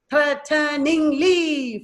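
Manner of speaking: THREATENINGLY slowly as threa, te, ning, ly; THREATENINGLY stretched into four even syllables. Shown as a demonstration. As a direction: sound out THREATENINGLY in four beats. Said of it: "four syllables".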